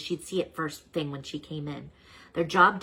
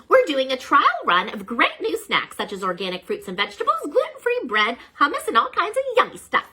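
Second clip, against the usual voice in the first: higher, louder cheerful schoolteacher; bright teacher voice; 9 semitones higher, 8 dB louder than usual. Acted. Miss Beasley making morning announcements, bright and higher. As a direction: announce it brightly to second graders. Teacher describes new snacks.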